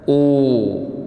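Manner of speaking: أو drawn out as a sustained "oo"; long madd vowel. Shown as a demonstration. Teacher demonstrating the waw of madd, long held "oo". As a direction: hold أو long as a pure "uu". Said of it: "وعندنا الواو ساكنة".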